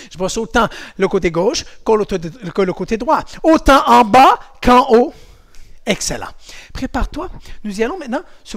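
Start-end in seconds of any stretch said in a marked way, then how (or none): 3.4-5.1 louder, slower, higher emphasis; emphatic. Shown as a demonstration. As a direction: stress both halves playfully. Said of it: "playful emphasis".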